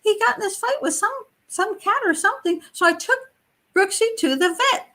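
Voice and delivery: high mock girlish explaining; sweet, childlike tenant. Tenant explaining the vet trip to cat's owner, high childlike mock voice. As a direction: give an innocent excuse in a little-girl voice.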